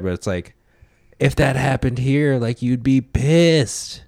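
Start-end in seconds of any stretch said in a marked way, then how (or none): 1.2-4.0 "pissed" stretched long, high and loud; raised voice, landing hard on a drawn-out "pissed"; emphatic. Real, not acted. Arguing it hits differently at home, with "pissed" stretched emphatically. Heated argument about distant troubles.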